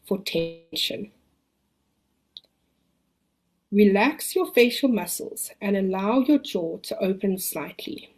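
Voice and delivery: calm the listener, long pauses. Acted. Leads a relaxation exercise.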